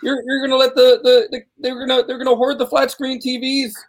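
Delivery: high-pitched mock voice; impression voice. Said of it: "That's a pretty good impression".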